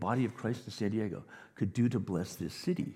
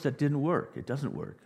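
ordinary talk

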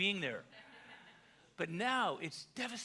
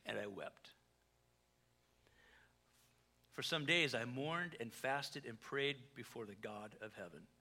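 sorrowful reading; 5 semitones lower, 3 dB quieter than usual. Acted. Nehemiah mourning, read aloud.

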